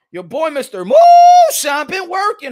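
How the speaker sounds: loud, high, slow showman's call; self-hyping holler. Real, not acted. Host bragging on his own research, hollered. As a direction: stretch out MOONSHINE in a big high holler. Hypes himself, then repeats it bigger.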